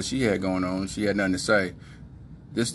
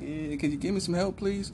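higher and softer, a small pleading ask; girl's mock plea; 9 semitones higher, 4 dB quieter than usual. Girlfriend asking for help, in a soft pleading voice. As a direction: put on a sweet helpless asking voice. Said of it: "her eyes are looking at him like".

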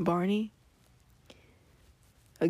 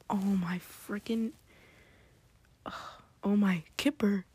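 ordinary talk, nothing marked

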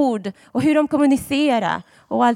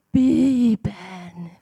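dramatic; 3 semitones lower than usual. Shown as a demonstration. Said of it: "Jag gjorde det väldigt dramatiskt".

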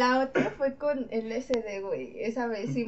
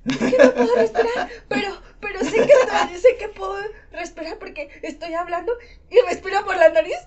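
high, loud, half-laughing panic; playing his own breathless panic; panicked pleading; 8 semitones higher, 7 dB louder than usual. Telling his friend about not being able to breathe, panicked.

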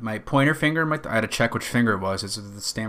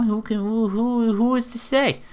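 much higher, slow, mock-profound; high mock musing voice; 11 semitones higher than usual. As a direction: ponder it in a high silly voice.

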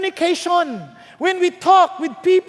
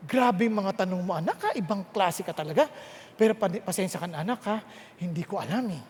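softer, lower, lightly voiced apologetic dad; a hint of a dad admitting he doesn't know; sheepish father; 11 semitones lower, 9 dB quieter than usual. Father apologizing to his curious son, lightly voiced and soft. Father answering son's questions.

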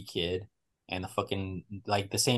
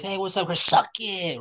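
higher teasing voice of a prankster; mock taunting friend; 9 semitones higher than usual. Friend greeting then taunting Chris, higher and teasing. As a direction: tease like a bratty buddy.

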